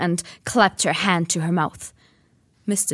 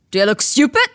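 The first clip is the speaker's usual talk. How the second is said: loud, higher angry snarl; 6 semitones higher, 10 dB louder, faster than usual. Acted. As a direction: snarl it with contempt. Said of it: "snarled Uncle Vernon".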